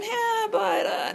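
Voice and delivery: high teasing voice of excuses; mock inner objection. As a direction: voice the doubting excuses. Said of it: "all the other things that were saying".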